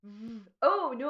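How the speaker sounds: ordinary delivery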